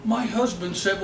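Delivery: ordinary delivery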